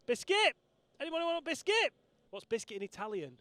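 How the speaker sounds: high, sing-song vendor's call. Acted. Presenter offering biscuits to passing riders, high calling voice. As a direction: pitch it up like offering treats to a crowd.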